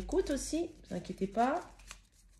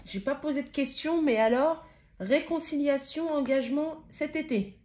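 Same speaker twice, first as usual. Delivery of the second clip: higher and louder, thrilled; excited; 6 semitones higher, 5 dB louder than usual. Real, not acted. Reader thrilled the cards speak before she asks, higher and excited. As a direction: rattle it off, thrilled.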